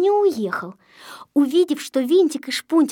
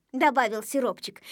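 plain reading voice

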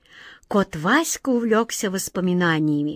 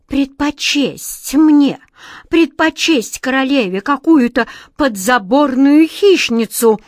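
slow, louder cry of wounded pride; indignant outburst; 4 semitones higher, 6 dB louder than usual. Acted. Mouse queen, jealous of a stray, cries out indignantly. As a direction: let the queen's outrage burst out slowly.

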